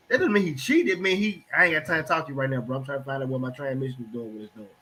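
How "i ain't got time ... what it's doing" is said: distracted brush-off. Distracted man brushing off partner.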